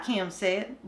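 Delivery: plain reading voice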